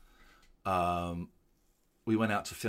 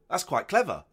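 ordinary delivery

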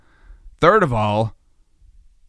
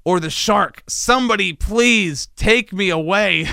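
high, hammed-up desperate wail; mock panic; 7 semitones higher than usual. Stranded podcaster begging to be taken away, mock panicked. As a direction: beg for rescue in comic despair.

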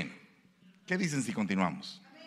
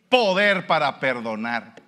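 loud slow proclamation; emphatic proclamation; 2 semitones higher, 10 dB louder, slower than usual. Real, not acted. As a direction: proclaim it slowly and loudly. Preacher's emphatic climax. (proclamación lenta y fuerte)

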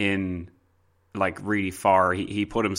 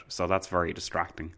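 ordinary talk, nothing marked

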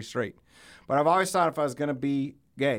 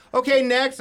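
mock brisk merch worker, higher and louder; merch worker cutting in; 10 semitones higher, 7 dB louder than usual. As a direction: hurry the line along. Merch person voiced in story.

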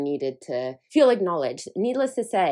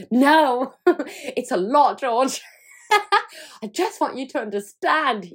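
higher, louder, laughing mock melodrama; wife's hammed-up plea to her husband, higher and louder; mock dramatic plea; 7 semitones higher, 5 dB louder than usual. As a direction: play up the big dramatic moment.

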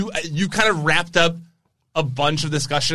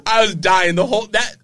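normal talking voice

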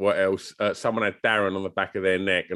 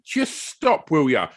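quoting Molly; 6 semitones higher than usual. Retold or imitated. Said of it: "Molly in the background going".

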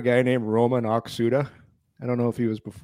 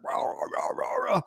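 mock Russian babble; 6 semitones lower, 4 dB quieter than usual. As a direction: fake a roommate jabbering in Russian. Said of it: "He's like".

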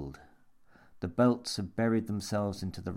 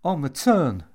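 high, loud gasp; gasped reply; 7 semitones higher, 9 dB louder than usual. A narrator's reading. Jimmy answers old man after helpless laughter, gasping. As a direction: gasp it out while still recovering. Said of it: "gasped Jimmy, still recovering".

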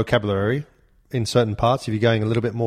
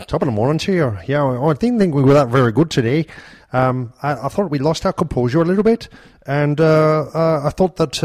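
a lightly voiced Irish football manager in a post-match interview; a hint of an Irish manager giving a post-match interview; Irish accent; 3 semitones higher than usual. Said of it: "He's an Irish accent, isn't he?".

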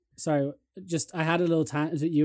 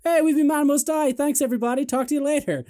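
loud, high frontman yell; higher and louder stage announcement; mock band shout; 12 semitones higher, 5 dB louder than usual. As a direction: ham up a singer signing off. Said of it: "and they just go".

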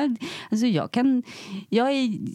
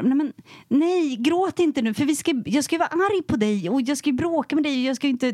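higher, flustered mock protest; imitating partner; 7 semitones higher than usual. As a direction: act out the frustrated partner. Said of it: "då blir det liksom så här". (härmar partnern, högre och uppgiven)

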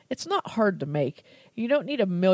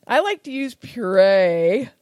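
ordinary talk

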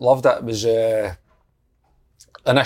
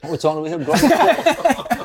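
incredulous laugh; 6 semitones higher than usual. Real, not acted. Mock disbelief at the name.

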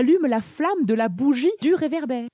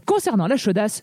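ordinary talk

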